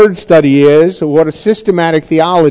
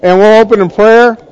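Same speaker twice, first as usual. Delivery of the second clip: raised, higher voice over the room; calling out; 7 semitones higher, 4 dB louder than usual. Real, not acted. Teacher to a chatting class before prayer, calling out. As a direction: call the class to order.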